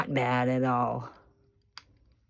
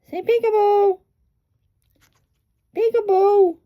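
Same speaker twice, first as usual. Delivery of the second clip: coaxing pet talk; 10 dB louder than usual. Real, not acted. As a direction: urge a bird on in a high, loud singsong. Urging the parrot to say peekaboo.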